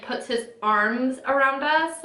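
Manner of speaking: usual speaking voice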